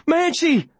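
high, urgent scream of the name. A narrator's reading. Boy screaming to his fighting dog, high and urgent. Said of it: "I scream".

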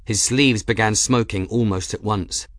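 ordinary delivery, nothing marked

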